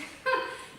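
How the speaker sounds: high, breathy burst of laughter; hearty laugh. A narrator's reading. Rescuer laughing at the sleepwalker's story. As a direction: throw back your head and laugh. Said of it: "threw back his head and laughed".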